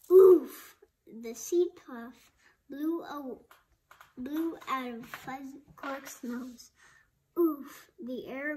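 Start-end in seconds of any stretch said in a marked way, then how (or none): 0.1-0.8 very loud, high oof; acted impact grunt. A narrator's reading. Cork lands on Fuzz. 7.4-7.9 loud puff of knocked-out air; acted winded oof. A narrator's reading. Air blown out of Fuzz.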